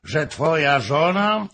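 loud, high taunt; shouted challenge. A narrator's reading. Fürstenberg bets against Hoym's wife, shouting. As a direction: throw the challenge out loudly. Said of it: "krzyknął Fürstenberg".

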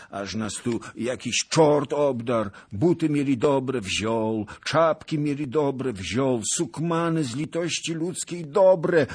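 high, loud wail of complaint; lamenting old beggar. Acted. Robbed beggar complains to a lord, wailing.

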